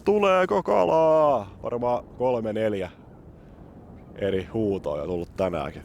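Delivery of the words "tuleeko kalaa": put-on high, slow, drawn-out call like a child on the bridge; a playful imitation of kids' calling; mock kid calling out. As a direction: call it out the way kids shout from the bridge. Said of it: "etenkin lapset huutelee".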